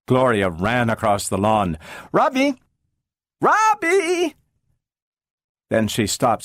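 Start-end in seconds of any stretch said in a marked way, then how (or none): 2.1-2.5 put-on high child call across the lawn; girl calling her robot friend. 3.4-4.4 put-on higher, longer child call; girl calling her robot friend again.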